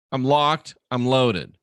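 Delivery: ordinary delivery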